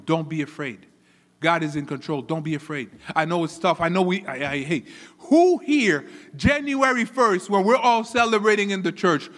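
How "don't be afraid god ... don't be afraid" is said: low, soft, steady comfort; calm reassurance. Real, not acted. Preacher comforting the congregation in hard times, low and calm. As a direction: bring the voice right down to reassure.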